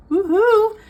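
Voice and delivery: high loud whoop; loud happy woohoo; excited whoop. Real, not acted. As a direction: whoop it out in celebration. Cheering the spicy books.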